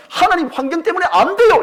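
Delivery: loud high protest; complaining cry. Retold or imitated. Believer tells God it cannot happen, loud high protest. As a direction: cry out the excuse. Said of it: "이렇게 말을 하는 거죠".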